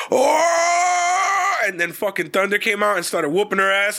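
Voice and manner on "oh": long high-pitched crowd "ohhh"; stretched, high mock crowd howl; crowd's "oh". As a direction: imitate a whole crowd reacting. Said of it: "they were like".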